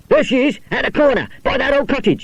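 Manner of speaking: high, loud calling out; shouting. Acted. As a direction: shout to the others where she is. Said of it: "shouted George".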